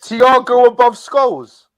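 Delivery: loud, high cry of disbelief; incredulous outburst. Real, not acted. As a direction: throw the ranking back in loud outrage. Disbelief at another's player ranking.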